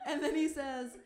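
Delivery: normal talking voice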